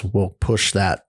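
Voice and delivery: ordinary talk, nothing marked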